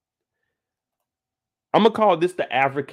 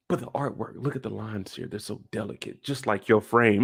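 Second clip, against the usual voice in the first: soft, hushed seducer voice; smooth flirt; 4 dB quieter than usual. Retold or imitated. Reed flatters her over the artwork, smooth and hushed. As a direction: imitate a smooth man's gentle compliment. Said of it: "this brother is smooth".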